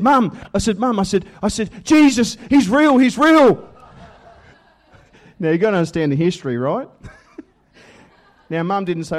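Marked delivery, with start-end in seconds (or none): 0.0-3.7 high, loud, overjoyed cry; excited shout. Retold or imitated. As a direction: burst in shouting the news. Bursting home to tell mum.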